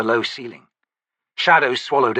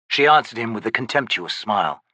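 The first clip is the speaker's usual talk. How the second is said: usual speaking voice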